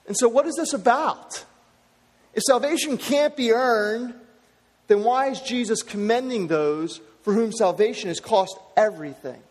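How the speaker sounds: ordinary delivery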